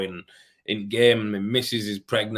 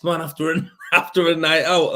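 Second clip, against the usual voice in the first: laughing; 7 semitones higher, 6 dB louder than usual. Real, not acted. Laughs while describing hangover gloom.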